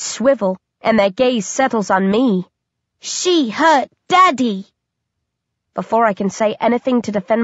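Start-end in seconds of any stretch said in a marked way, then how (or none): 3.0-4.7 put-on slow, eerie child voice, high and drawn out; creepy babies blaming her; eerie baby accusation. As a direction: accuse her like a ghostly infant.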